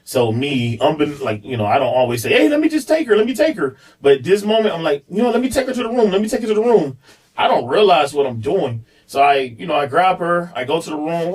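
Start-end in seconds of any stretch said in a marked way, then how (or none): 2.3-3.8 eager high offer. Retold or imitated. Eager offer to take daughter. 5.1-7.0 hurried eager offer. Retold or imitated. Pressing to take daughter away.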